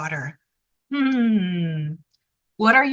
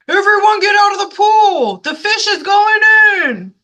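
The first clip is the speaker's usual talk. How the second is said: fast, loud, higher shouted warning; yelled warning; 10 semitones higher, 8 dB louder than usual. Acted. Firemen warning swimmers at the pool, loud fast yell. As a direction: clear the pool with a big shout. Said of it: "The firemen yelled".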